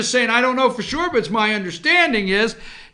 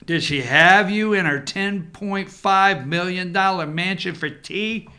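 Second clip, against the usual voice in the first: ordinary talk, nothing marked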